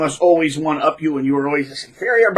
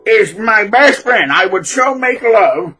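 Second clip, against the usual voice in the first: mock dreamy gushing; 4 semitones higher, 4 dB louder than usual. Guitarist spacing out about his guitar, mock dreamy. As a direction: gush like a spaced-out lover. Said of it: "he'd blank out, space out".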